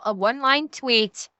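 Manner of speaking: ordinary talk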